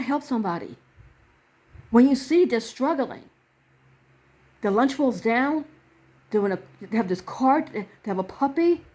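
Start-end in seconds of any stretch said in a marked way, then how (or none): none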